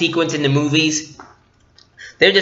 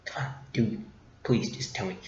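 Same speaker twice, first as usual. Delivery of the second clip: hushed, much softer and lower; whispered plea; 6 semitones lower, 16 dB quieter than usual. Retold or imitated. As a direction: whisper it so she can't hear. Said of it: "so he's like".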